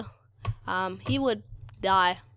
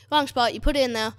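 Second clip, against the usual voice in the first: usual speaking voice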